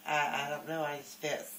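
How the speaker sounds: normal talking voice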